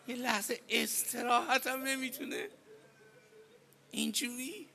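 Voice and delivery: high, choked voice, rising at the end; tearful question. Real, not acted. Preacher laments that the son gets no rest, tearful. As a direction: plead it again through tears.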